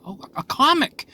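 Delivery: "comic" lifted high in a bright aha; oh, finding the word at last; sudden realization. Real, not acted. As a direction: snap onto the word "comic" as it comes back.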